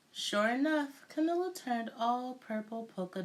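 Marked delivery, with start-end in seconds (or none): none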